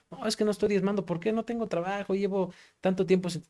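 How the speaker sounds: lightly voiced woman making excuses; apologetic woman. Voices woman explaining herself to pastor.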